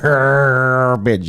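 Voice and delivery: long, exaggerated growly stretch of the word; hammed-up drawn-out mimicry; mocking echo. Mocks the debaters' American word.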